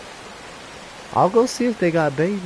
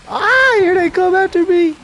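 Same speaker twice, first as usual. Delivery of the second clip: high panicked yelp as they charge; startled yelp; 5 dB louder than usual. Real, not acted. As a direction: squeal out in real fright. Monster charges at player.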